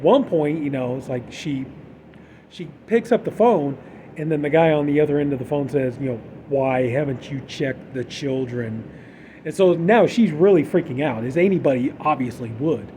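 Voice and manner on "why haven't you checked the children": slow creepy caller. Retold or imitated. Caller escalates.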